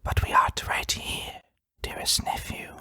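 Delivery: eerie breathy whisper close to the ear; dark whisper. A narrator's reading. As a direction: whisper it darkly, like a ghost at his ear. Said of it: "a voice darkly whispered".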